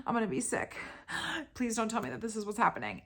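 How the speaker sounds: high-pitched, put-on voice of a panicking mother; imitating dramatic mom. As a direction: play mom about to be sick from shock, hammed up. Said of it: "she's so intense and dramatic".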